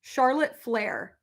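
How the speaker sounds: slow, deliberate name for a voice search; slow for the assistant. Real, not acted. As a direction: spell it out slowly for the phone. Speaking to voice assistant.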